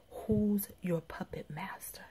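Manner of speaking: soft, slow, hushed inner voice; imitating a small, hushed voice; little small voice. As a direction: ask it quietly and slowly, like a small voice within. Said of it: "I heard this little small voice".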